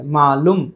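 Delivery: मालूम said as "maalum", short u; Hyderabad-style short-u मालूम; wrong short vowel. Shown as a demonstration. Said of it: "मालूम गलत है".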